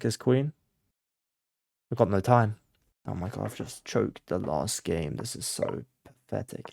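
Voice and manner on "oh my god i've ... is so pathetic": low, soft, deflated mutter; dejected self-reproach. Real, not acted. Player who choked the last game, quiet dejection. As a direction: let it sink away into breath. Berating self after losing the queen.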